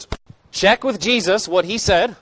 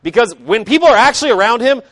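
normal talking voice

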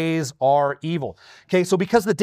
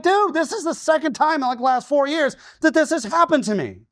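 exasperated; 11 semitones higher than usual. Retold or imitated. Exasperated retelling.

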